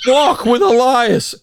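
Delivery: loud, high, drawn-out mock yell; imitated wrestler's yell. Mimicking a wrestler yelling to an empty arena, loud yell. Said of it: "and now he yells".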